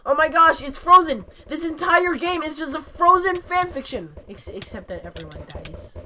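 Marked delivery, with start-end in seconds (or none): 0.1-4.1 high, loud, building exclamation; loud, high-pitched hammy revelation; excited realization. Real, not acted. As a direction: shout the discovery with mounting amazement. Mock-amazed realization about Frozen.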